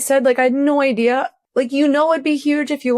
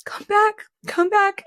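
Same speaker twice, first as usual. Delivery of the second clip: imitated calling after; 10 semitones higher, slower than usual. Dreamer calling after her departing friend, imitated calling after. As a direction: lightly act out calling someone back. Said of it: "calling after her".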